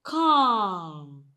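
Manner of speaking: "calm" with a drawn-out "ah"; long vowel. Shown as a demonstration. Teacher reads sixth example word, long vowel. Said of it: "this particular sound, sound occurs in words like".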